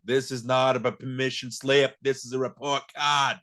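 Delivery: mock Boston accent, forceful and drawn out; overdone Boston movie accent. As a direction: imitate an actor laying on a Boston accent. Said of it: "with the Boston accent being like".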